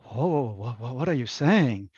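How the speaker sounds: mock shock, stammering over the question; playful stammered disbelief; imitated taken-aback reaction. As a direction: act out someone startled by a word. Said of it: "people look at that word and go".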